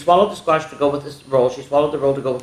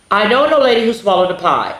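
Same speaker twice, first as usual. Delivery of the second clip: storybook refrain; 5 semitones higher, 5 dB louder than usual. Acted. Reading the refrain aloud.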